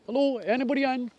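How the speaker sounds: high, fast, loud call into the shelter. Real, not acted. Finding a hut in the woods, calling out loudly to anyone inside. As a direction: hail whoever might be inside.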